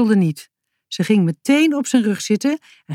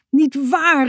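higher protest, called out; calling out; 7 semitones higher than usual. A narrator's reading. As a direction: call it out to Sisi. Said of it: "riep Boutje". (hoger, roepend protest)

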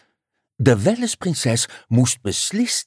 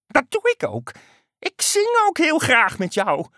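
high, upbeat frog voice; frog calling out. A narrator's reading. Frog reassuring its singing partner, upbeat and high. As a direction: exclaim as an eager frog. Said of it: "riep de kikker".

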